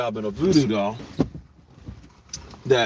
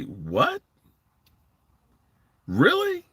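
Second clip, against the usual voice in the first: disbelief; 7 semitones higher, much slower than usual. Retold or imitated. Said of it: "you just kinda say".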